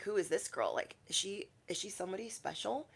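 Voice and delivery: very soft, nosy aside; hushed onlooker. Acted. Newcomer at her work wondering about her, hushed. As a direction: ask it under your breath.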